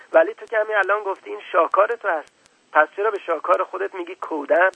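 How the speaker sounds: put-on a boy catching an adult out, a bit quick; little prince.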